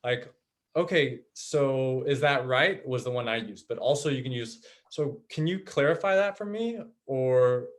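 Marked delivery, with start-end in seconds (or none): 0.7-2.9 slow, with the tune rising at the end; gentle checking question, the pitch climbing on "right"; rising intonation. Shown as a demonstration. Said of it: "the intonation goes up". 4.9-6.8 higher question, tune lifting at the end; rising intonation. Shown as a demonstration. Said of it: "the intonation goes up".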